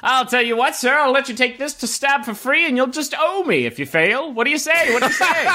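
high-pitched sales patter; enticing game vendor. Acted. Vendor urging a player to try, high coaxing patter. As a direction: coax them in like a fairground hawker. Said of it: "one particularly enticing vendor who's".

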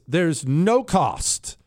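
"no cost" drawn out slowly, pitched high; slow, emphatic weight on "no cost"; stress on NO COST. Real, not acted. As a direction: hammer home "no cost", each word stretched. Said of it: "I'm gonna stress this".

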